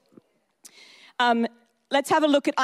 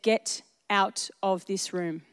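God speaking; 4 semitones lower, 5 dB quieter, slower than usual. Retold or imitated. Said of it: "and he said".

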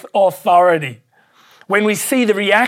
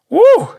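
excited whoop; 7 dB louder than usual. Real, not acted. Preacher exclaims in excitement.

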